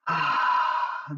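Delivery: deliberate long inhale, then audible exhale; conscious breathing. Shown as a demonstration. Teacher demonstrating God being present, slow conscious breath. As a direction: breathe in slowly and let it out. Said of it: "when you breathe in".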